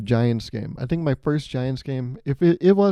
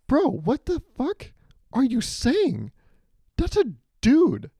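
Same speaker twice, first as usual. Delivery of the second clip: high, slow incredulous protest; hammed-up exasperation; appalled disbelief; 12 semitones higher, slower than usual. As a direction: drag it out, aghast at a heckler. Appalled reply to the heckler.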